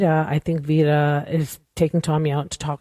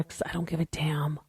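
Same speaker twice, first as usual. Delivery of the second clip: whispered aside; 9 dB quieter than usual. Real, not acted. Aside about giving up.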